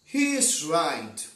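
loud, slow declaration; storybook character agreeing firmly; blind man's line. A narrator's reading. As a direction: read it out slowly and emphatically, with volume. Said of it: "said the first blind man".

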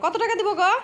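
high, loud mock-astonished question; surprised. Real, not acted. Wife teases husband over his fee, loud and astonished. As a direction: act shocked at the price.

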